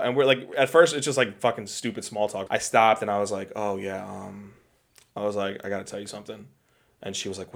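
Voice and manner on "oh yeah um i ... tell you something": soft, hesitant mock confession. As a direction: replay a nervous lead-in, very quietly. Retelling a nervous lead-in to proposing.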